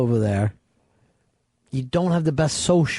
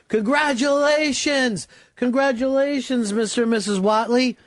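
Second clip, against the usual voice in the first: cheering guests; 7 semitones higher than usual. Pretend.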